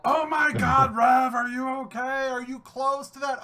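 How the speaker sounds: high, anxious friends asking; fussing worried online friends; mock worried guildmates. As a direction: play the panicked guild friends. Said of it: "everyone in my guild".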